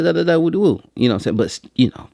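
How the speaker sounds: usual speaking voice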